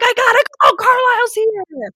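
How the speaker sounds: high, loud hammed-up panic; mock panic. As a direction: squeal it like a frantic teen bolting. Mock Bella rushing off.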